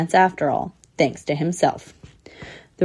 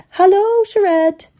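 loud, very high greeting call; high-pitched, hearty hail; 14 semitones higher, 5 dB louder, slower than usual. A narrator's reading. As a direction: sing out a hello to someone passing. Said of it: "he called out".

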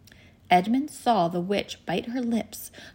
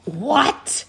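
loud, high roar of disbelief; explosive, outraged shout; witch's roar; 8 semitones higher, 7 dB louder than usual. A narrator's reading. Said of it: "roared the witch".

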